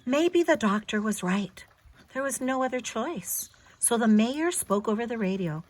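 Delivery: gentle storybook read-aloud; clear, expressive storyteller voice for a little one; reading to a child. Acted. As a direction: read it like a bedtime picture book. Reads picture book to child.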